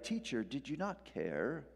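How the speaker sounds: softly spoken mock-British question. Preacher mimicking how disciples didn't say it, mock British. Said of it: "Always British, right, in the, in the Bible movies".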